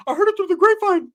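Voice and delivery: very high, loud cartoon squeak; [name]. Inmate explaining how he heard the rumour, high cartoon squeak. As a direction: answer in a put-on Mickey Mouse voice. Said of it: "that high Mickey Mouse voice".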